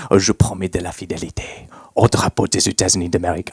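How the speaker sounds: soft, fast, whispery come-on in French; seductive French murmur. Acted. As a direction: whisper it low like sweet nothings. Flirting in French with classmates.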